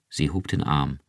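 usual speaking voice

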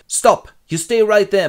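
high, loud order to halt; a hammed-up stranger barking stop; commanding shout. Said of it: "man appears and goes".

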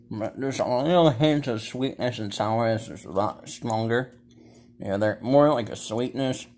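talking with mouth full; chewing. Real, not acted. Seen 1: Gummy bitten at -4; repeated bites at 2.5, 4.2, 5.4 while talking.

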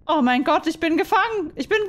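high, loud alarmed realisation; hammed-up captive panic; acted panic. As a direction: play waking up to find you are trapped. Character discovers she is captive.

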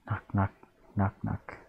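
usual speaking voice

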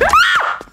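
sudden high scream of fright; real startled scream. Real, not acted. Gamer jumps at a scare, high startled scream. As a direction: shriek as the scare hits.

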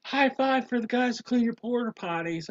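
high, loud mock holler; imitating a loud, high-pitched heckler; calling out. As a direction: shout it out to passing strangers. Friends calling out to passersby.

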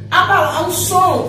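ordinary talk, nothing marked

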